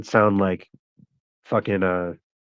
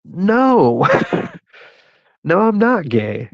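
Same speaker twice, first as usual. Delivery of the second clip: laughing denial; 10 semitones higher, 6 dB louder, slower than usual. Retold or imitated. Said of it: "I was like".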